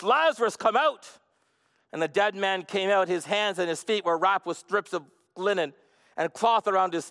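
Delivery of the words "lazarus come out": higher-pitched summoning cry; calling out loudly. A narrator's reading. Jesus summoning Lazarus from the tomb, calling out. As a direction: call the name out like a command. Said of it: "he called out in a loud voice".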